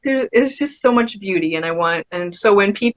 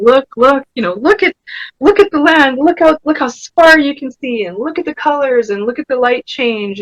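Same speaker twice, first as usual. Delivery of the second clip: raised, excited urging to look at the land; eager mother urging; 6 semitones higher than usual. Retold or imitated.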